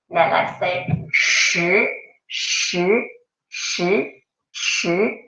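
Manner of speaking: slow shi with a falling tone; demonstrated shi. Shown as a demonstration. Teacher modelling a slow, falling shi for the class. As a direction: draw out shi and let it fall. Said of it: "pay attention to how I say shi".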